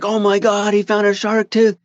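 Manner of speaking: high-pitched imitated excitement; excited discovery. Billy's excited reaction, retold.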